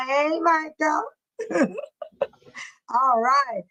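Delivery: usual speaking voice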